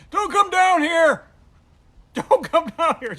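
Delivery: loud, high, slow yell; panicked shout. Retold or imitated. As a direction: stop her coming down, frantic. Yelling up stairs panicked.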